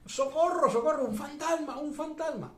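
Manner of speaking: high-pitched cries for help; imitated frightened shouting. Preacher voicing disciples scared of a ghost, high panicked shout. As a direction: shout in mock panic, voice jumping way up.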